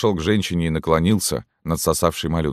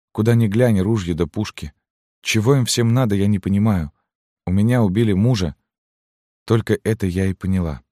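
higher-pitched woman's voice, plaintive; bewildered widow; 5 semitones higher than usual. A narrator's reading. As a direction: lament without understanding, pitched up. (растерянная вдова, повышенным голосом)

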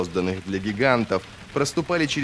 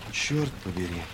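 muttered; 2 semitones lower, 6 dB quieter than usual. A narrator's reading. Pale Luthar staring at the ruins, muttering to himself. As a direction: mutter it under the breath. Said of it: "пробормотал он".

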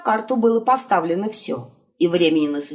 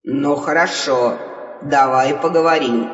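grudgingly agree, annoyed; irritated; 5 semitones lower than usual. Acted. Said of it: "раздражённый мужской". (раздражённо, низким голосом)

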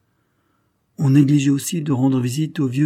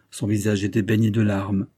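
ordinary delivery, nothing marked

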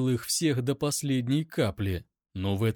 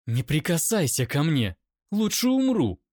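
higher, rising fierce rejection; seething refusal; 5 semitones higher, 4 dB louder than usual. A narrator's reading. Captive woman forbids him to touch her, seething. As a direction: build to a high, defiant cry. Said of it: "процедила она".

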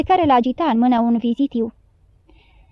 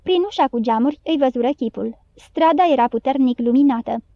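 ordinary delivery

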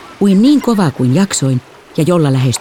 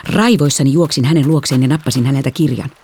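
usual speaking voice

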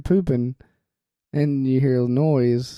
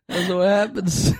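ordinary talk, nothing marked

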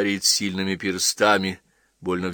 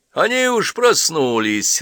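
louder, higher, slow and hearty; cheerful, hearty; 9 semitones higher, 7 dB louder, slower than usual. A narrator's reading. Old man announces they are already awake, cheerfully. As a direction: call it out in good cheer. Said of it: "раздался бодрый мужской голос".

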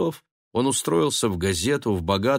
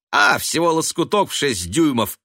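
breezy, raised-voice dismissal; offhand; 6 semitones higher, 5 dB louder than usual. A narrator's reading. Son shrugging off mother's horror, offhand. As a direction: toss it off loudly, no big deal. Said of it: "сказал он небрежно".

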